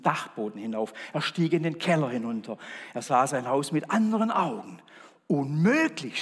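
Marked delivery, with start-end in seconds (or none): 5.3-6.2 louder cry of dismay; shout. Acted. Man sees his dirty house, crying out. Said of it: "schrie er".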